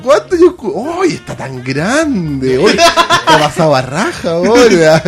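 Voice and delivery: high fussing auntie; imitating old aunt. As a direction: gush over the grown-up nephew. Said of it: "Que me llame mi tía".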